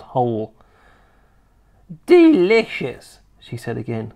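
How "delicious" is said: high, loud, drawn-out savouring; relishing old grandma. Acted. Grandma relishing slugs before her grandson, gloating.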